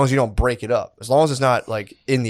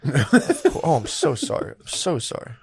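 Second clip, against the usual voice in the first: overly polite; 2 semitones higher, 5 dB quieter, slower than usual. Retold or imitated. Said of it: "I get really polite and I go".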